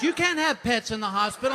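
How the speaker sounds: high, loud prim nurse voice; mock nurse, high and loud; nurse quote. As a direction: sound like a stiff nurse repeating a rule. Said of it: "And she goes".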